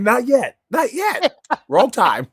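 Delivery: mock caution. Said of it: "He's almost saying".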